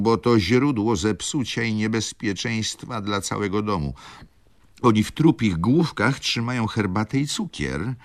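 high-pitched, overwrought lament; tearful pleading. A narrator's reading. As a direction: plead hysterically, close to tears. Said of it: "Zaczęła znowu tak płakać". (płaczliwe, histeryczne błaganie)

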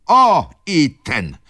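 slow, high, loud outcry; exclaimed accusation. A narrator's reading. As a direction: cry out in indignation at him. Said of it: "zawołała baronowa". (głośny, oskarżycielski okrzyk)

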